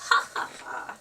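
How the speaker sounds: loud, put-on "ha, ha, ha"; acted belly laugh. A narrator's reading. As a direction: Grandfather Tree's hammed-up laugh. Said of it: "he loved to laugh".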